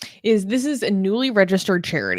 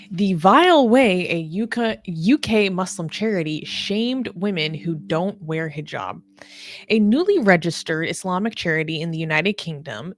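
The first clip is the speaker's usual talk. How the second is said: normal talking voice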